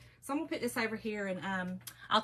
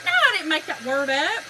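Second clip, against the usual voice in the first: loud, raised-pitch comeback; indignant retort; 4 semitones higher, 10 dB louder than usual. Retold or imitated. Mom denying it to her boys, loud indignant retort. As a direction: fire back in mock protest.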